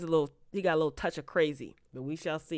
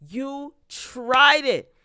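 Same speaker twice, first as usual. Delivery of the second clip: very slow, louder "You tried it"; slow emphatic callout; 7 semitones higher, 8 dB louder, much slower than usual. Real, not acted. Telling the writer off, slow and loud. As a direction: drag each word, calling it out.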